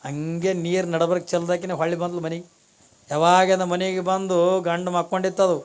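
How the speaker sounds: ordinary delivery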